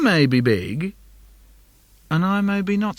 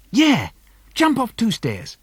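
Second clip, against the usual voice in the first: put-on higher, eager kid voice; little brother egging on; 8 semitones higher than usual. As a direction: cheer him on like a little brother. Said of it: "He says".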